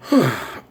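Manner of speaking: real heavy exhale; dejected sigh. Real, not acted. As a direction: sigh before admitting it hurt. Saddened after telling the ending.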